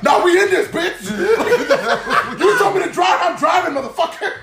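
high-pitched hammed-up outlaw talk, amused; mock getaway bravado. Bus driver turned getaway driver to robber, mock bravado. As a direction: play the getaway high and excited.

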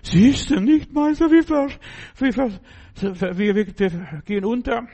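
high, loud stammering panic; panicked cry. Acted. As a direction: disciples crying out as they sink. Panicking disciples in the storm.

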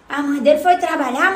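ordinary talk, nothing marked

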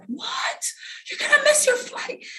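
high, panicked mom voice; worried mom. Retold or imitated. Mom scolding her son about missing his flight, high and fretful. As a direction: fret like a mom at the clock. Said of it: "my mom is like".

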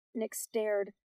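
ordinary delivery, nothing marked

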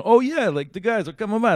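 high-pitched mock Italian invitation; Italian cliché. Host imitating the pizza maker saying drop by, Italian cliché. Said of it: "Italian kind of cliche".

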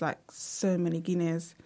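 ordinary talk, nothing marked

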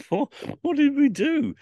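mock-bewildered cry. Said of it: "and we're going".

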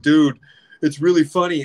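loud, high, fast scolding from an annoyed friend; mock exasperated yell. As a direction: yell it like a fed-up pal. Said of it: "people get mad at me and they're like".

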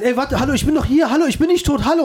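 nachgespielte Panik, höher und drängend; panisches Rufen. Retold or imitated. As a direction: ruf immer verzweifelter, dass dich jemand hört. Aufwachender Mann wird ignoriert. (Mann im Sarg ruft die ignorierenden Trauergäste, panisch und hoch)

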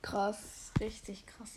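awed whisper. Real, not acted. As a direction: breathe it out in awe. Awed reaction after fight.

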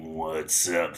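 low, dark villain greeting. Shown as a demonstration. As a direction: growl it like a supervillain. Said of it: "you should be like".

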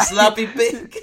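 mock angry caller. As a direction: toss out the insult like the angry caller did. Quoting the caller's insult drop.

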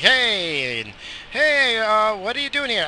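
high, surprised hey-what-are-you-doing-here voice; mock surprised greeting. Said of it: "Be like".

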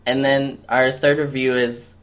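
ordinary talk, nothing marked

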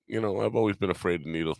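ordinary talk

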